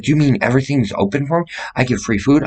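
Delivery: fast high excited asking; retold excitement. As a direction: rush it out in giddy disbelief. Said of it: "I was like".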